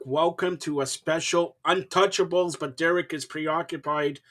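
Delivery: ordinary talk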